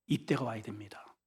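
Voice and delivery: soft unvoiced whisper. Real, not acted. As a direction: lean in and whisper it. Whispered aside. (속삭이듯 조용히)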